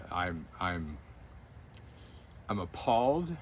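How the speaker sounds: normal talking voice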